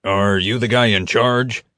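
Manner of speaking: a touch of gravel and menace; deep gravelly voice. A narrator's reading. Prisoner questions his captors through the bars, gruff gravelly voice. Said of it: "the same deep, gravelly voice".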